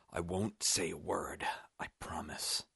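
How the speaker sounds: slow, fading near-whisper; hushed pleading. A narrator's reading. Dying man promises Roop silence, in a hushed plea. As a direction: promise it weakly, trailing to a whisper.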